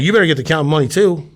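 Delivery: commanding. Acted. Ordering dealer to count.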